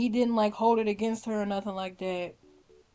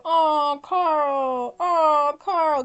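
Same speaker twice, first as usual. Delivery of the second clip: swooning aww; 6 semitones higher, 7 dB louder, much slower than usual. Retold or imitated. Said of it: "every time I was like".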